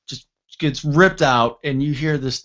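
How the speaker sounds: ordinary delivery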